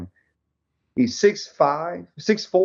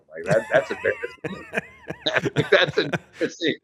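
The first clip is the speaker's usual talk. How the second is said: sheepish laugh over the words; laughing at himself; 5 semitones higher than usual. Real, not acted. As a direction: laugh at your own failure. Punch fails to break window.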